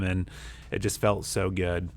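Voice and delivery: ordinary delivery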